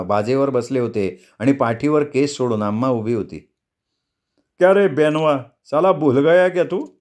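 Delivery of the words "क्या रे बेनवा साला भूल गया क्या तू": higher-pitched voiced questioning of a boy; rising, higher character voice; questioning guest. A narrator's reading. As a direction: put on a guest's teasing challenge. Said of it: "रघुरामानं विचारलं".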